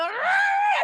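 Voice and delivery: high-pitched drawn-out "ah"; frantic mock cry. Mom swerving left, frantic squeal.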